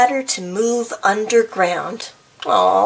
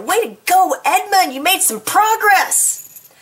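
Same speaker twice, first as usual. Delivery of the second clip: cheering; 10 semitones higher than usual. Real, not acted. Reader cheering Edmund's small progress, high excited cheer. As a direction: cheer him on proudly.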